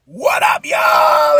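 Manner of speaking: loud, high call to the listeners; shouted greeting. Real, not acted. Host opening his show with a shouted hello. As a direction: hype up the crowd with a shouted hello.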